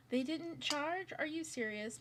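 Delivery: gasping in dismay; real surprise. Real, not acted. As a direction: caught off guard by dead headphones. Finds headphones uncharged, dismayed.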